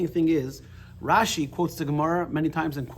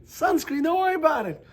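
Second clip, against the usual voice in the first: high breezy reassurance, much higher; God reassuring; 14 semitones higher, 4 dB louder than usual. Retold or imitated. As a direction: brush it off lightly as God. God answers in joke.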